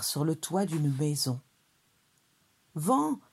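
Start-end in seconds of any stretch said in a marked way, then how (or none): 2.7-3.2 high-pitched call to the wind; crying out. A narrator's reading. Souriceau stuck on a roof, crying out high. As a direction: call out high, as to someone far above. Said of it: "s'écria le souriceau".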